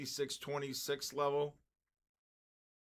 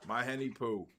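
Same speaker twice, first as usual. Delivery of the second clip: ordinary delivery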